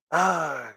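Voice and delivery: short choked-off cry; a scream cut short; stifled scream. Acted. Missus Lorday facing the bat-like shape, stifled scream. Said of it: "A stifled scream is choked off".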